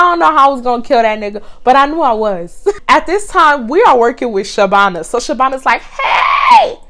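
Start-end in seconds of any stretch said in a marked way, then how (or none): 5.8-6.8 shrieked high Hey; panicked manager's shout; short Indian lady. Retold or imitated. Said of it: "imagine a short Indian lady".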